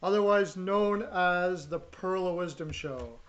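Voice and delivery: loud, drawn-out announcer voice; show host intro. Acted. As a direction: welcome the audience like a radio host. Opens his show as host.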